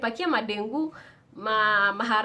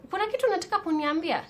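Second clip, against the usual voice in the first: a hint of a stern mother, higher and quick; stern mom; 9 semitones higher, faster than usual. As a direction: lightly play the strict mother pressing her daughter. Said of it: "she was so stern and so strict".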